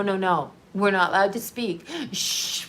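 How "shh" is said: mocking shush. Mocking people who police swearing.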